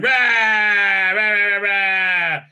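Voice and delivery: mock roaring creature, high and loud; hammed-up growling chant of the creatures; monster roar. Dregs creatures roaring at the crew, mock monster rah. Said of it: "Driggs is going".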